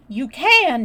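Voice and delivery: forceful contradiction; angry shout. A narrator's reading. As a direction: shout back hard. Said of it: "shouted Mary".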